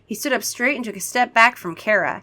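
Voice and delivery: ordinary delivery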